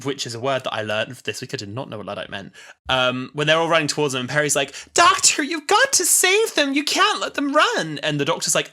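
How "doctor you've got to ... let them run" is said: high, frantic companion impression; mock urgent plea. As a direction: plead urgently, pitched way up. Voicing companion pleading with Doctor.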